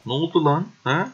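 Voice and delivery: startled high yelp of a question; surprised. Real, not acted. Gamer startled by sudden turn in battle, surprised. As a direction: caught off guard, pitch jumps up.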